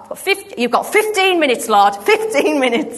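half-laughing plea against the clock; urgent high plea breaking into a laugh; urgent bargaining. Retold or imitated.

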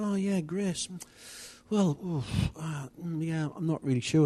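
acted hesitation. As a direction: imitate someone groping for an answer. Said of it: "you say something like".

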